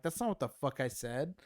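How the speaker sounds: worked-up, defensive objection; indignant protest. Retold or imitated. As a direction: retell an outraged protest to mom. Retells angry protest to mom.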